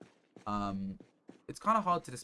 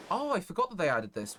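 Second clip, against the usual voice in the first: sudden delighted realization, higher and louder; surprised; 8 semitones higher, 5 dB louder than usual. Real, not acted. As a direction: caught off guard by a discovery. Surprised by new game feature.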